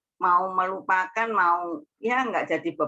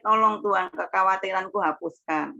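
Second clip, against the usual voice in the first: ordinary delivery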